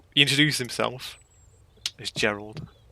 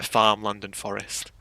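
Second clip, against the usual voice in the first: ordinary talk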